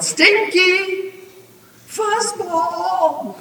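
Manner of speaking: high, loud, drawn-out yell. A narrator's reading. Grandma yelling from the tree, loud summoning holler. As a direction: holler names as if summoning animals. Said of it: "And she yelled".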